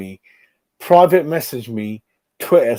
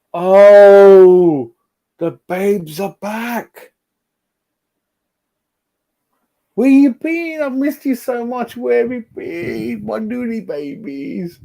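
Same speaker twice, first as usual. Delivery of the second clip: high, sing-song coo to his babies; cooing baby talk; 10 semitones higher, 6 dB louder, slower than usual. Acted. Streamer greeting returning viewers, high cooing baby talk. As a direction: gush over the viewers like they are babies.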